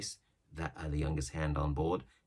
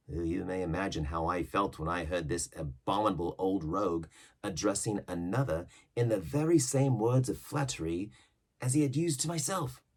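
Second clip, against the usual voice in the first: usual speaking voice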